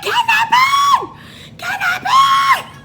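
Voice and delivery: very high, drawn-out scream for help; mock yell. Passenger pretending to alert nearby cops, long mock scream. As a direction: holler it slowly out a car window. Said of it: "I will yell".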